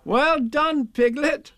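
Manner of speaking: put-on high, hearty boyish praise; high-pitched congratulating call; encouraging. A narrator's reading. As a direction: cheer the little one on. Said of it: "these encouraging words".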